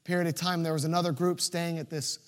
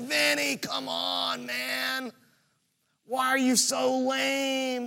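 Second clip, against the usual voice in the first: teasing call; 6 semitones higher, slower than usual. Retold or imitated. Friends calling back teasing.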